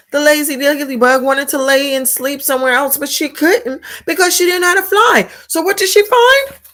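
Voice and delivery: high, lively read-aloud voice; animated storytelling. A narrator's reading. Teacher reading aloud to the class, high and animated. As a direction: lift it up like reading to little kids.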